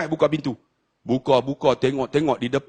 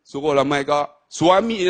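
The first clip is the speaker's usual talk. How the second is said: ordinary talk, nothing marked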